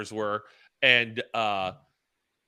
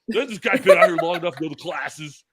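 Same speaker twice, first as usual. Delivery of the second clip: exasperated boss; 3 semitones higher, 6 dB louder than usual. Retold or imitated. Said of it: "Bob Wright".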